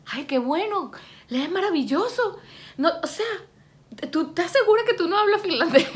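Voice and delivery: high-pitched imitation of an impressed teacher; amazed teacher. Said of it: "la profesora".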